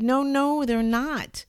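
high, loud insistent call; neighbor's loud call. Retold or imitated. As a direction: insist he come over, calling out. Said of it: "He said".

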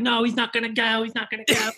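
high-pitched, insistent Australian holler; yelling Australian accent. Acted. Imitating characters in a domestic incident, yelled Australian accent. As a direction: protest in a high put-on Australian yell. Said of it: "like, yelling in Australian accent".

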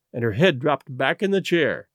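ordinary delivery, nothing marked

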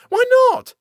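high, loud petulant retort. A narrator's reading. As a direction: snap back like a sulky girl. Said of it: "said Violet petulantly".

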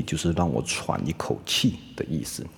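ordinary delivery

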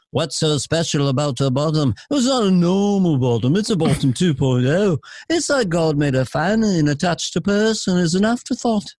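lilting Liverpool back-and-forth. Acted. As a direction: puzzle it out in Scouse. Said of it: "[name] talking to [name]".

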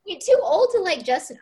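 ordinary talk

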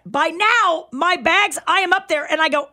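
ordinary talk, nothing marked

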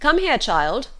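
father's brisk cry; calling out. A narrator's reading. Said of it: "cried her father as she appeared".

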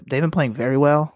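ordinary talk, nothing marked

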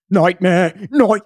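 high, loud Australian outburst; imitating an Australian freaking out; attempted Australian accent. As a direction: cry out in a shaky attempt at Australian. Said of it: "an Australian accent, but he's like".

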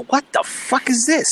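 high, soft, drawn-out incredulous quote; retold disbelief. Wrestling booker reacting to his wrestler's song pick, incredulous.

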